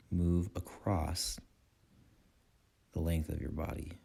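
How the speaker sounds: calm guiding voice in short phrases with long pauses; guided meditation. Acted. Meditation teacher guiding listeners' attention through breath and body, calm guided meditation. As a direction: lead them unhurriedly through the breath, leaving space after each phrase.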